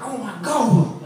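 high, drawn-out astonished exclamation; mock amazement. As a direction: imitate her being floored. Acted amazed reaction of the woman.

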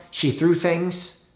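ordinary talk, nothing marked

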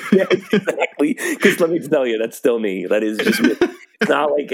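high laughing agreement. Real, not acted. Agreeing with co-host's sippy cup joke, laughing. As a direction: laugh through the words.

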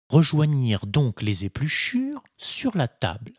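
ordinary delivery

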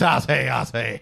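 hammed-up genteel Southern drawl; classy Southern accent. Joking about the partner's new voice, classy Southern accent. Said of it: "that's a classy Southern accent".

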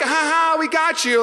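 high, loud taunting ha ha; kids taunting. Retold or imitated. Kids with big soakers taunting him, high gloating. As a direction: gloat like a smug kid. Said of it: "And they're like".

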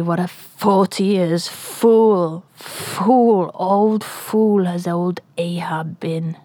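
slow, whispered self-reproach; bitter whisper. Acted. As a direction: whisper it, slowly, hating yourself. Ahab lamenting his wasted years.

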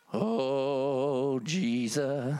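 low, drawn-out groan of wonder; mock awe. Preacher reacting to a hinted miracle, low mock awe. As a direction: drop way down and stretch it out. Acting his awed reaction to offer.

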